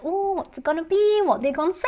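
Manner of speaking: high, eager mock excitement. John waiting for Pepsi's letter, eager. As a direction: bounce with anticipation. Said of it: "He's like".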